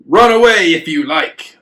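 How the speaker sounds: booming mocking giant voice; bellowing giant. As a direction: bellow it to taunt the soldiers. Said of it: "the giant bellowed".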